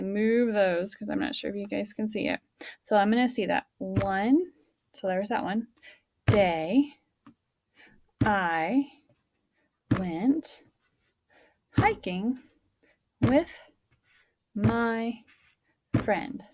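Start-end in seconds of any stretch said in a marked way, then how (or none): 6.3-16.4 one word at a time, long pauses between; word-by-word dictation for young pupils; pounding out each word. Shown as a demonstration. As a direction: tap out every word slowly like a drum beat. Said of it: "So I pounded out the words".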